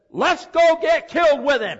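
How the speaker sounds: rally the others to go and die with Jesus; bold rallying shout. Retold or imitated. Thomas urging the apostles on, fearless loud call.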